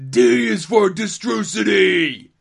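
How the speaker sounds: loud, slow wrestler shout; Ultimate Warrior. Acted. Podcaster quoting a wrestling comic to co-host, Warrior impersonation. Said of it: "Is that your Warrior impersonation?".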